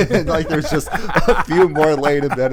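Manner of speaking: words through laughter; laughing. Real, not acted. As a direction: laugh while you talk. Laughing while retelling scene.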